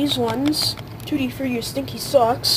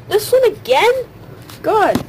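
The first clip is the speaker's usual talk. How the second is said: high, loud groan of dismay; dismayed outburst; 9 semitones higher, 6 dB louder than usual. Real, not acted. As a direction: cry out in disbelief. Same bad bean lands again.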